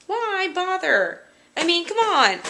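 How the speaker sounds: high-pitched, drawn-out exasperation; exasperated. Real, not acted. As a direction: plead in high, stretched-out disbelief. Still complaining about the cookie renaming.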